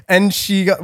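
usual speaking voice

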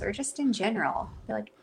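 ordinary delivery

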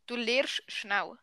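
slow Swiss German dialect; drawn-out Swiss German demo. Shown as a demonstration. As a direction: say it slowly in Swiss German for a learner. Swiss German take after the translation.

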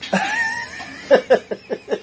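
high, shrieking burst of laughter; losing it completely. Real, not acted. Laughs at mom shout.

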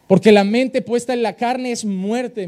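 loud, slow scripture read; emphatic scripture reading. Acted. Pastor proclaiming the verse to the congregation, slow and loud.

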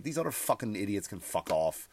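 usual speaking voice

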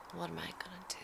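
a tired sigh melting into a whisper, fast; whispered despair under a sigh. Real, not acted. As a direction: breathe it out, defeated and hushed. Despairing over bugs.

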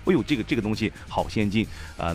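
ordinary talk, nothing marked

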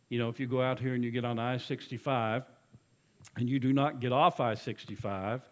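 usual speaking voice

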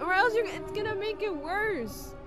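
high-pitched, agitated fussing; alarmed scolding. Acted. Annika keeps fretting at friend.

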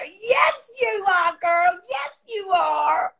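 high, drawn-out holler; hammed-up high-pitched encouragement; whooping cheerleader friend. Imitates Debbie cheering her on.

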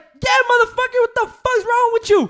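high, loud angry shout, slowing at the end; furious retold shout. Driver yelling at a stranger opening his car door, furious shout. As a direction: blast him for opening your door. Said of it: "I'm like".

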